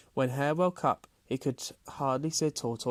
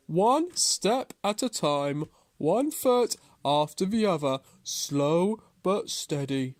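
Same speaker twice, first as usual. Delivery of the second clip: slow, deliberate tortoise voice, raised at first; patient tortoise; 4 semitones higher, 4 dB louder than usual. A narrator's reading. Tortoise explains his method to Hare, slow and deliberate. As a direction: plod through it phrase by phrase.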